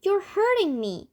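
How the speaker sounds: high-pitched protest; narrated cry of protest; pained cry. A narrator's reading. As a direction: complain loudly that it hurts. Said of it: "cried Grandma Jo-, Georgina".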